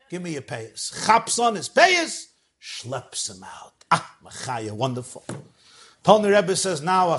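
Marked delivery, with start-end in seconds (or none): 0.1-1.1 quiet command. Acted. Rebbe commands man in fire.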